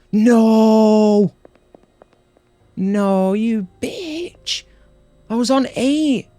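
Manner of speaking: high, loud furious outburst; frustrated yelling. Real, not acted. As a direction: rage at losing progress. Lost progress in game.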